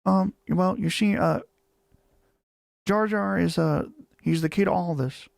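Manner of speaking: light imitation of an old filmmaker explaining; a hint of a musing director; George Lucas impression. Streamer tries the impression for chat, lightly voiced. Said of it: "The George Lucas impersonation always kills me".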